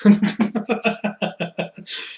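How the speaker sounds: high breathy giggle; giggling at own mistake. Real, not acted. Laughs at own slip.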